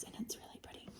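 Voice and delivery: hushed, unvoiced whisper. Real, not acted. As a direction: drop into a real whisper for an aside. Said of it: "I don't know why I whispered that".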